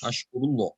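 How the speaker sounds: "Ashkurullah" with ru and a dark, rounded law; full ending. Shown as a demonstration. Teacher repeats that way for students, "Ashkurullah" with full ending. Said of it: "That way".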